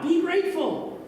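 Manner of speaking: high, emphatic sermon punchline; hammed-up pulpit slogan; mock preachy line. Shown as a demonstration. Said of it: "I could just make it up right now".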